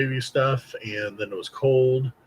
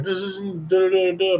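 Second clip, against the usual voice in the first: high, slurred da da da of a numb mouth; numb-tongue talk; 9 semitones higher, 3 dB louder than usual. Retold or imitated. A numb kid trying to talk to mom, slurred and high. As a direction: mumble like your tongue is numb. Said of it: "I was like".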